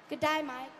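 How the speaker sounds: soft Australian-accent prompt. Shown as a demonstration. Mother prompting her son to say it, Australian accent. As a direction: model an Australian accent quietly for a child. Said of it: "in Australian accent".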